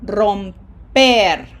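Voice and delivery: Romper with long vowels, second syllable hit hard; loud teacher demo of Romper, stressed at the end; long vowels, final stress. Shown as a demonstration. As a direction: stretch Romper and punch its last syllable. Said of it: "Long vowels and emphasis on the red syllable".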